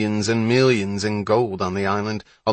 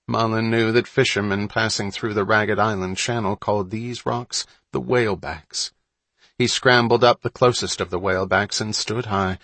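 ordinary delivery, nothing marked

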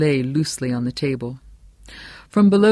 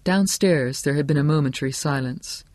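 ordinary delivery

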